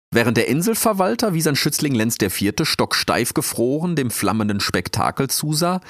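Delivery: usual speaking voice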